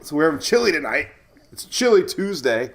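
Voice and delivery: ordinary delivery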